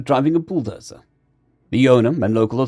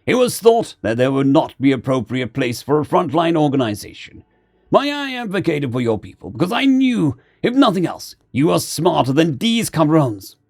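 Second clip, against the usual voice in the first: raised pitch, speech-making commander; Mori the commander; 3 semitones higher than usual. Acted. Commander explaining to the otters why he advocated for them, firm. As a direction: address them as their commander.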